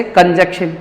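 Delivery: कंजक्शन as "kanjekshan"; pupils' wrong pronunciation. Retold or imitated. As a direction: leave out the middle N in कंजक्शन. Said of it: "क्या पढ़ते हैं इसे?". (कंजक्शन बिना बीच के न के)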